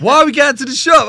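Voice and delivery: loud, high, retold exasperation; exasperated question.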